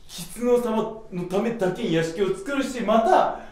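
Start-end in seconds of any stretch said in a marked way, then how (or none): none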